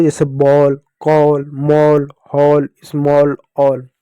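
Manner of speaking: बॉल, कॉल, मॉल, हॉल, स्मॉल, ऑल with an open aw sound, slowly; words stretched slowly, vowel as aw; reading example words. Shown as a demonstration. Teacher showing pupils the aw sound in a slow word list. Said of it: "इसी रूप में आप औ को भी पढ़ोगे".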